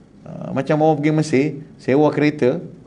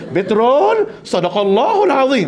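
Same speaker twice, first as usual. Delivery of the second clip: high, chanted Quran-style ending; imitating a pilgrim reciting at a mechanic; mock recitation; 7 semitones higher, slower than usual. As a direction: intone it like closing a recitation. Pilgrim misuses Quran phrase.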